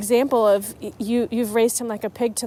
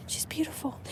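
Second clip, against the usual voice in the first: breathed admiringly, much softer; soft whisper; 6 semitones higher, 12 dB quieter than usual. Real, not acted. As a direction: whisper it fondly. Admiring the bunny.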